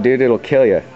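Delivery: ordinary delivery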